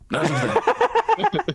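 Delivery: comic squeaky sidekick calling out; high, slow squeaky voice. Acted. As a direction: pitch way up and draw it out. GM voices Talon pointing out boats.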